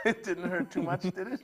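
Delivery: teasing question through a laugh; real laughter under a friendly check-in. Real, not acted. Host teasing guest while laughing.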